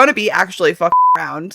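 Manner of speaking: ordinary talk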